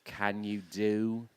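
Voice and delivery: slow, low British imitation. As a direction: lightly imitate a very British woman, slowly. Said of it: "She was very British".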